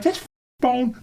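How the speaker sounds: very high, incredulous customer rant; retold screaming. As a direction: replay yourself yelling at a store manager. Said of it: "I started screaming".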